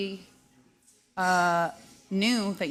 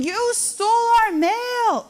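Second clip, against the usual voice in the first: high, loud, stretched-out mock yell; imitated child's yell; 14 semitones higher, 7 dB louder, slower than usual. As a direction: mimic a little girl yelling an accusation down the street. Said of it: "she's yelling".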